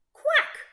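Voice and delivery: high loud quack; gasped quack. A narrator's reading. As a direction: gasp out a duck quack. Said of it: "gasped Duck".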